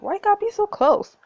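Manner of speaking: loud and high, creeped out; real disgusted yell at the duke; exasperated outburst. Real, not acted. Player yells at the looming duke, exasperated.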